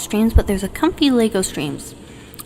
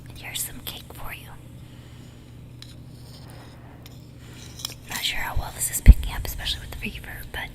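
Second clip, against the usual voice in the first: soft breathy ASMR whisper; 6 dB quieter than usual. Real, not acted. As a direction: whisper them to sleep. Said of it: "maybe I can try some A S M R".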